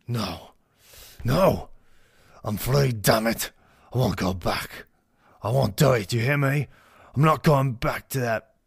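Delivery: high, loud, desperate refusal; acted angry defiance; defiant outburst. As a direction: refuse with everything you have.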